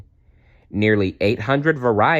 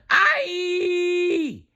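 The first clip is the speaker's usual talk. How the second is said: piercing very high bird shriek. Acted. Flap falling in the dark, piercing shriek. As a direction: scream in terror. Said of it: "shrieked Flap".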